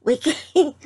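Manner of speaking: words breaking into high laughter; laughing through words. Real, not acted. Telling viewers a family word, cracking up. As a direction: try to talk but crack up.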